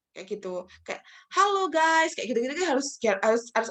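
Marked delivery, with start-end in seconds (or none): 1.3-2.1 louder, upbeat casual greeting; friendly hello. Shown as a demonstration. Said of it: "friendly gitu".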